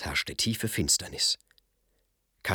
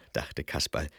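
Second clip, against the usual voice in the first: plain reading voice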